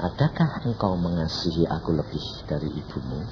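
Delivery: low, soft, solemn voice of God; God's voice. Retold or imitated. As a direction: ask it gravely and quietly, as God testing a servant. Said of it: "Tuhan bilang apa?". (suara Tuhan yang rendah dan lembut)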